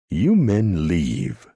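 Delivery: loud, slow and formal; pronounced firmly. A narrator's reading. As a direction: pronounce each word slowly and loudly. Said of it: "pronounced H. Leroy Ham".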